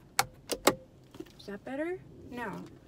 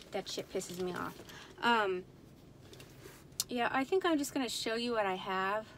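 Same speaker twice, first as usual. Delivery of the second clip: usual speaking voice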